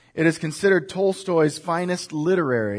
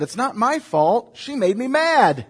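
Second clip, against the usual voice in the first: put-on whiny teenager; 7 semitones higher than usual. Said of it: "the teenager ... said".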